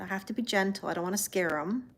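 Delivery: usual speaking voice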